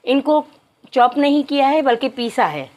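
usual speaking voice

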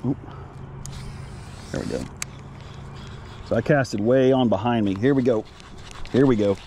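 usual speaking voice

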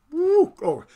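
sudden high, loud whoop of praise; praise outburst. Real, not acted. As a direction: burst out whooping in real praise. Praise outburst while reading scripture.

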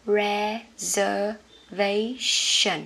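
reservation, very slowly, syllable by syllable: re-zer-VAY-shun; slow careful pronunciation. Shown as a demonstration. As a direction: stretch out reservation, stressing VAY. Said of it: "cái này sẽ là". (đọc thật chậm từng âm reservation)

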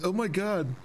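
high-pitched mocking impression of curious strangers; mocking nosy people. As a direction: imitate people asking where he is from. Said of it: "People hear accent".

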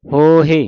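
long ho, then hi in ਹੋਹੇ; sounded h. Shown as a demonstration. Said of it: "ਹਾਹੇ ਹੋੜਾ ਹਾਹੇ ਸਿਆਰੀ". (ਹੋਹੇ ਹਾਹੇ ਸਿਆਰੀ ਨਾਲ)

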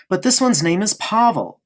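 put-on a boy refusing to be doubted; firm childish insistence; insistent. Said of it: "insisted Bruno".

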